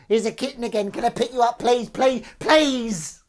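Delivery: high, loud, pleading kitten voice; whiny voice. Acted. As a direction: beg to be picked up, whining. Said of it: "the whiny voice".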